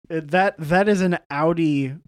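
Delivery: normal talking voice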